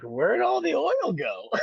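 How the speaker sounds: high, slow squeak of mock disbelief. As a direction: cry out like you can't believe the oil vanished. Shocked at missing oil.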